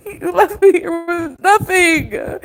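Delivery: real tearful plea, slow; slowed, weeping accusation; crying. Real, not acted. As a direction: say it through tears. Crying during the fight.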